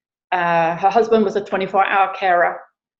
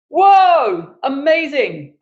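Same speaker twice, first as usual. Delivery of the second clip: high, loud whoop of amazement; amazed cheer; 7 semitones higher, 5 dB louder, much slower than usual. Retold or imitated. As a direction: cheer like an astonished onlooker. Acting out the onlookers' amazed cheering.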